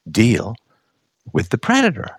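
usual speaking voice

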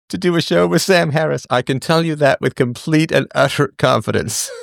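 high-pitched, laughing refusal; laughing incredulously. Real, not acted. Host rejecting a show with Sam Harris, laughing incredulously. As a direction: protest through helpless laughter.

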